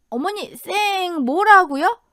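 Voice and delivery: high, slow, drawn-out question; feigned deafness. A narrator's reading. Daughter-in-law pretends not to hear mother-in-law, mock puzzled. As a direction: pretend you can't catch a word.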